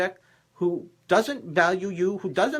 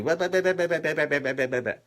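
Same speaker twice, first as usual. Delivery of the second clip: run-on babble imitating an argument; mock bickering; 3 semitones lower, faster than usual. Imitating a couple who argue in the mall, mock bickering. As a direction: rattle off blahs like a squabbling pair. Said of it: "a couple, they argue".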